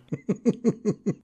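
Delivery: a high burst of laughter. Real, not acted. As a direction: crack up at your own joke. Laughing at own diabetes joke.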